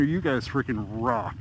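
ordinary talk

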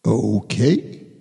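plain reading voice

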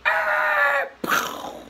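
long high squeal, then explosive pow; imitated screech and bang. Storyteller imitates the crash heard by phone, screech and bang. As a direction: mimic a loud screech ending in a crash.

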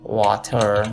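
slow "water" with the final r sounded; water with pronounced R. Shown as a demonstration. Teacher naming the example word, r-ful "water". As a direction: draw out "water", keeping the r.

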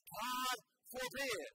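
slow, weighty God forbid; strong negation. Retold or imitated. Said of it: "that very strong phrase".

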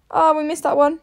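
ordinary delivery

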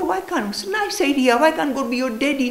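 put-on high, gently coaxing a small child; gentle coaxing voice. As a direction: coax the little boy kindly. Teacher voicing himself to child.

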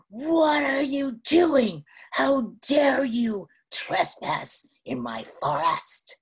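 slow, drawn-out monster threat; huge monster. Acted. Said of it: "this huge monster".

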